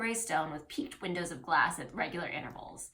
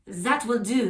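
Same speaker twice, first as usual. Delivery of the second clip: put-on French accent; 3 semitones higher, 6 dB louder, slower than usual. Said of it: "oh, he's French".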